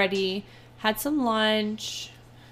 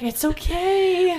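gentle soothing; 8 semitones higher, 3 dB louder, much slower than usual. Retold or imitated. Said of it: "literally so gentle".

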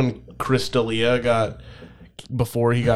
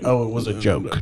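ordinary talk, nothing marked

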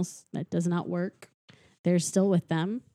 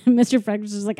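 ordinary talk, nothing marked

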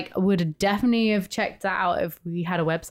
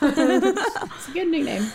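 laughing; 9 semitones higher, much slower than usual. Real, not acted. Laughing reply.

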